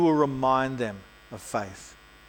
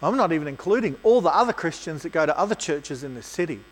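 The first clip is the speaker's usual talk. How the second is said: usual speaking voice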